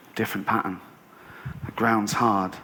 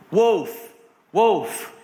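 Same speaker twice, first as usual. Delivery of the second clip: raised, drawn-out cry; mock alarm call; 5 semitones higher, 3 dB louder than usual. Bored boy calling villagers as a joke, mock alarm.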